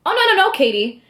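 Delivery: high-pitched playful objection; sassy protest. Retold or imitated. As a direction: wave off the doubt with sass. Retelling her sassy reply to judge.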